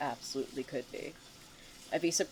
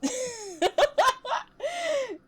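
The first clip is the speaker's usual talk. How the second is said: high, loud cackle of amusement; gleeful taunting laughter; loud mocking laugh; 8 dB louder than usual. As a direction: laugh long and high at someone's misfortune. Taunter laughs at friend's deaths.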